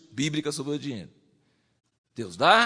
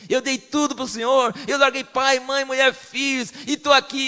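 higher, louder martyr lament; self-pitying martyr; 8 semitones higher, 4 dB louder than usual. Retold or imitated. Preacher mocks the martyr believer, higher and louder. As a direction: play the long-suffering believer. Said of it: "Aquele que fala assim".

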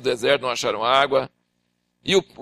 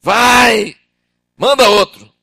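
loud begging refusal, higher; pleading Moses; 6 semitones higher, 9 dB louder, much slower than usual. Acted. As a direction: beg God to send someone else, loud.

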